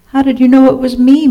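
high, quick dismayed moan. A narrator's reading. Turkey, seen through his horse costume, moans. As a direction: moan it high, rising at the end. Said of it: "moaned Turkey".

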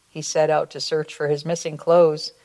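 usual speaking voice

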